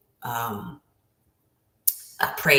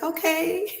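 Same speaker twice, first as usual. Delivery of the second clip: tiny high-pitched nervous okay; mock scared. Student facing the teacher in her face, mock scared. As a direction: squeak it meekly, playing it for laughs. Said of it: "I'm scared".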